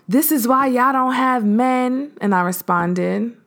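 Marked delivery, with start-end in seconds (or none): 0.1-2.1 mock insulting man. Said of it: "he proceeded to say".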